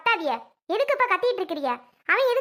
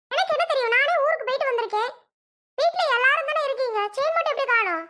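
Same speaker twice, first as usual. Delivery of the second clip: pitched high, defensive and upset; indignant protest; 6 semitones higher than usual. Acted. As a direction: fire the questions back, voice up high. Quarrel over the missing chain. (உயர்ந்த குரலில் கோபமான மறுப்பு)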